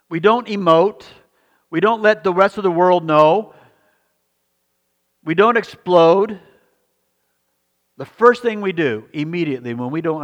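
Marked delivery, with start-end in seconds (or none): 0.1-6.4 forceful preaching. Acted. Pastor exhorting the congregation, forceful preaching. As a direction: hammer each short line out.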